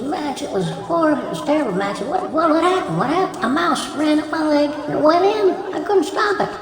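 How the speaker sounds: high, frantic mock distress; acted panic. Wife tells husband of the mouse, in high mock panic. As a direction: blurt it out in comic alarm.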